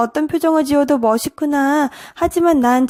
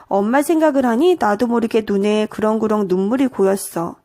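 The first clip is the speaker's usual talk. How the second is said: usual speaking voice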